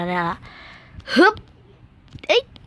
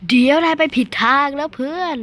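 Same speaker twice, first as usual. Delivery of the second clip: teasing scold; 6 semitones higher, 5 dB louder than usual. Real, not acted. Scolding game character.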